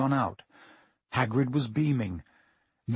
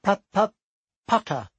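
nervous stammer; 7 semitones higher, 5 dB louder, slower than usual. Acted. Quirrell greeting Harry, nervous stammer. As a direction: stutter on the P, timid. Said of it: "stammered Professor Quirrell".

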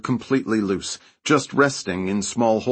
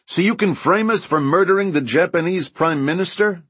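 raised voice, pitched up high; shouting; 8 semitones higher, 3 dB louder than usual. A narrator's reading. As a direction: shout it back across the roof. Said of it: "Joe shouted back".

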